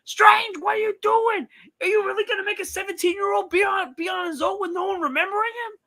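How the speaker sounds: high, loud outraged caller rant; Mad Dog impression. As Mad Dog calling Doctor Strange, high-pitched shouting. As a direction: scold Doctor Strange like a sports host. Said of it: "Mad Dog calls and be like".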